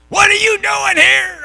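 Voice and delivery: high, loud, slow mock challenge; hostile resident. Householder loudly challenging the preacher at the door. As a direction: demand it with suspicion.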